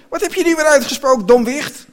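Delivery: high, loud, fast scolding woman; angry scolding. A narrator's reading. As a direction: tear into the child at speed. Vrouw scheldt kind uit. (hoog, luid en snel uitfoeteren)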